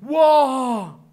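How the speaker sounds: long, loud, high "wow"; awestruck exclamation. Acted. Frog seeing the river for the first time, awestruck. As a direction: burst out in astonishment at the huge sight.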